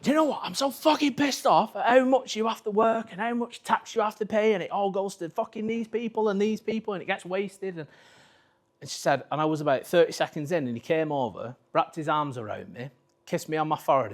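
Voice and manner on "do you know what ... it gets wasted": high, loud mock rant; imitating the wife's furious tax rant; ranting wife impression. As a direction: rant in a put-on shrill voice, fired up. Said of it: "and I was like".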